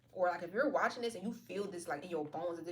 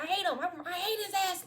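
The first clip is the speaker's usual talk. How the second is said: high, louder acted exasperation; mock outburst of hate; 11 semitones higher, 4 dB louder than usual. Reader voices someone waking up hating an ex, high mock outburst. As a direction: blurt it high, hamming up the hatred. Acts out sudden morning hatred.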